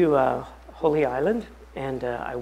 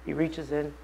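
ordinary talk

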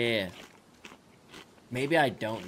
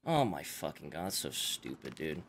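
disgusted mutter; 10 semitones lower, 11 dB quieter than usual. Real, not acted. Defeated disgust.

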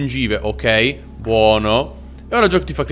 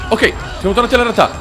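high, very fast, still rattled; panicked relief; 6 semitones higher, much faster than usual. Real, not acted. Player just back to reality, still panicked. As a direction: rush it out high after the panic.

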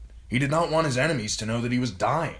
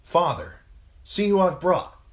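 high, loud call to Father; 12 semitones higher, 8 dB louder than usual. A narrator's reading. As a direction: rouse an old man with a raised voice. Son calling to his bedridden father.

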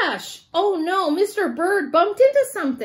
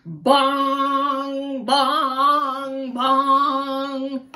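slow, drawn-out loud bong like a big bell; 4 dB louder, much slower than usual. Pretend. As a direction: boom it out like a church bell. Bell ringing sound effect.

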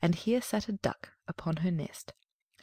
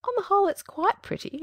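proud mother; 14 semitones higher, 6 dB louder than usual. A narrator's reading.

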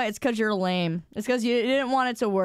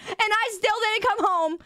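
ordinary delivery

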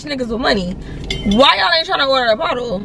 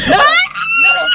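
laughing shout; 5 dB louder, much slower than usual. Retold or imitated. Retorting a guy's claim.

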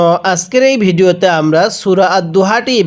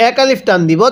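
ordinary talk, nothing marked

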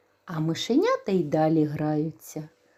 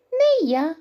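mouse shouting; 11 semitones higher, 6 dB louder than usual. Acted. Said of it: "гукає верть".

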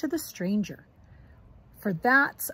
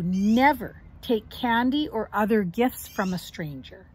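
usual speaking voice

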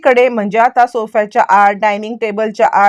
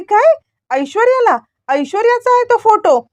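much higher, astonished gasp of a question; high, stunned disbelief; shocked realisation; 10 semitones higher than usual. Acted. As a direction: she suddenly realises who the girl is. Lata realises whose photo.

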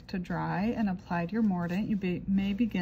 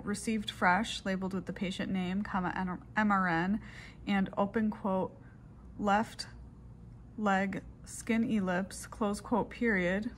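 dictating. Shown as a demonstration. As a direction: dictate it clearly into the recorder. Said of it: "I will go ahead and do those steps in a dictation format".

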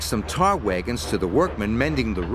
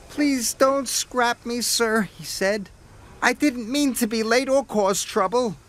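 pleading Percy; 10 semitones higher than usual. A narrator's reading. Percy begging Sir Topham Hatt not to scrap him. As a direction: plead in a high little engine voice.

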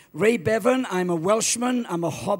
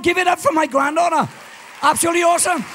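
higher, louder, fast shout to the crowd; rousing call to applaud; 8 semitones higher, 6 dB louder, much faster than usual. Real, not acted. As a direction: whip up the applause.

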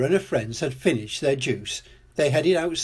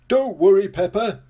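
high, loud storybook reassurance; hearty reassurance; 7 semitones higher, 6 dB louder than usual. A narrator's reading. Grampy Rabbit reassures, booming.

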